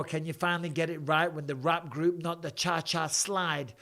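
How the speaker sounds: ordinary talk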